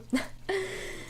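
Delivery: soft, high-pitched giggle; quiet giggle. Real, not acted. Player jokes the fish hid in the castle, soft giggle. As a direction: giggle softly to yourself. Laughs about the hidden fish.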